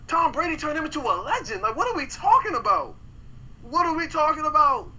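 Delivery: heated outburst. Real, not acted. Heated sports argument.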